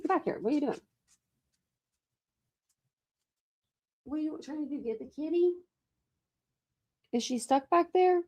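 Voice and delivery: gentle scolding voice for a puppy; pet talk. Real, not acted. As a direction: coo at the pet, way up high and hushed. Talking to the pet.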